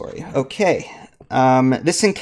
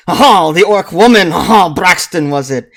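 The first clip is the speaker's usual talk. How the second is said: louder, higher mock French clerk; 3 semitones higher, 6 dB louder than usual. Clerk recalling the orc woman, mock French accent. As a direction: toss it off in a hammed-up French accent. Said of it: "I'm just gonna add aha".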